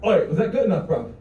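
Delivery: put-on casual British accent; cheeky British lad accent. Shown as a demonstration. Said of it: "[name] sounded really close to [name]".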